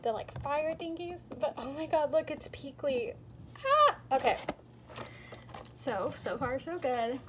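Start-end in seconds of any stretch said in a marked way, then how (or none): 3.6-4.0 excited shriek. Real, not acted. Shrieks at rare figure.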